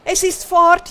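high, loud lament; hammed-up storybook woe; wailing. A narrator's reading. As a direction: wail over a loss. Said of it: "jammert er".

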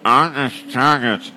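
"eines Tages" through a blocked nose, nasal consonants gone; a brother with a cold threatening his sister, stuffed nose. Acted. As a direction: say "eines Tages" like your nose is completely clogged. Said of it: "dank seiner vollkommen dichten Nase".

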